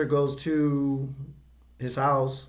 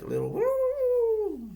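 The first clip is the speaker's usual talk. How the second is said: imitating Gizmo's tiny high coo, slow; a long, squeaky little woo like a creature; slower than usual. Kid imitating the Mogwai's high little woo sound. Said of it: "He hears, like".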